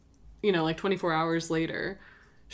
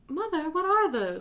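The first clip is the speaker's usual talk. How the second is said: put-on high, curious girl voice; young Rapunzel voice; 10 semitones higher than usual. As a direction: ask like a wide-eyed girl. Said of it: "Rapunzel's like".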